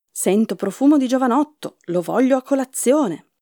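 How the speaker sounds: a storyteller's mildly thundering ogre; thundering giant. A narrator's reading. Hungry giant announcing he smells a boy, lightly thundering. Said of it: "Poco dopo un vocione tuonò".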